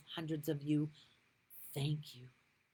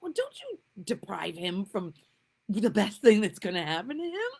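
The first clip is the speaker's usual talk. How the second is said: excited urging; 12 semitones higher, 8 dB louder than usual. Retold or imitated. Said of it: "I was like".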